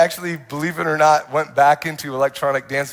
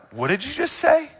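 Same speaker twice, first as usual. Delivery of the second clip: higher, startled disbelief; stunned question; 10 semitones higher than usual. Retold or imitated. To the stranger in the club, stunned and incredulous. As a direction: ask as if you can't believe your ears.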